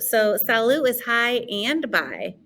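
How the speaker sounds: ordinary talk, nothing marked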